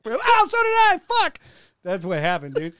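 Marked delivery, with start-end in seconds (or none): 0.2-1.0 mock yelp of pain. Mock being stabbed in back.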